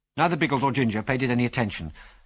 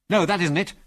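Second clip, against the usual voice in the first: raised-pitch, insistent exclamation; urgent cry; 6 semitones higher, 3 dB louder than usual. A narrator's reading. Ginger correcting Biggles on spotting a plane, urgent cry. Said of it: "cried Ginger".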